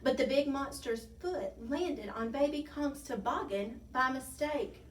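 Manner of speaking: plain reading voice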